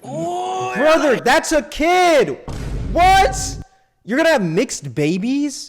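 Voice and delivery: high, loud, stretched-out shock; incredulous outburst, pitch shooting up; outraged disbelief. Real, not acted. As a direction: cry out in appalled disbelief. Outraged reaction to clip.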